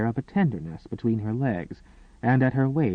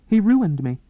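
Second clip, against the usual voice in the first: higher, louder choked cry; 9 semitones higher, 6 dB louder than usual. Acted. Woman telling the maid what happened, choked. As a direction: force it out through a tight throat. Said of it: "she choked".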